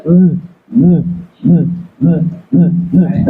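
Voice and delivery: humming the nasal sound; nasal humming demo. Shown as a demonstration. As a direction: hum it through the nose. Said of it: "Ada suara gini".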